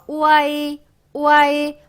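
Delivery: 우아이 blended on a high level tone; pronunciation demo. Shown as a demonstration. Teacher modelling the blend for learners, pronunciation demo. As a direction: hold 우아이 high, gliding through all three vowels. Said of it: "이렇게 발음이 돼요".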